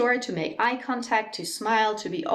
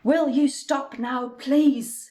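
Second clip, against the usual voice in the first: impolite demand; 4 semitones higher, slower than usual. Shown as a demonstration. Teacher demonstrates the impolite sentence as a slow, curt demand. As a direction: lay out each word slowly, like an annoyed order. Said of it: "incredibly impolite".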